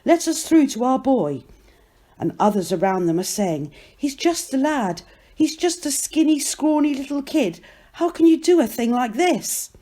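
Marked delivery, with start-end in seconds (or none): 0.0-1.4 higher-pitched plea to get past; contained but urgent appeal; urgent cry. A narrator's reading. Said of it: "they cry". 4.0-9.7 high-pitched, mildly outraged plea; indignant protest. A narrator's reading. Crowd protests on boy's behalf.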